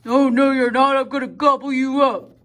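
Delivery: loud, mean and big troll voice. Acted. Troll bellowing down at the little goat from under the bridge. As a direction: boom it out like a big ugly troll. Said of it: "mean and big and bad and ugly".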